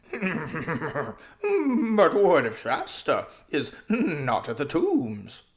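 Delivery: loud, slow, laughing horse voice; a horse chuckling with eagerness; eager horse, laughing. Acted. Bree, horse character, eager.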